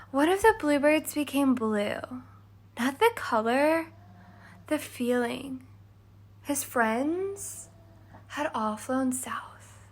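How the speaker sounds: put-on hushed, dreamy little girl; Cindy's voice. A narrator's reading. Cindy telling a bird story, fading to a whisper. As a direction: drift into a soft whisper.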